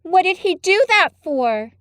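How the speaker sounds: put-on a sharp little girl asking; curious girl. Mary asks the gardener.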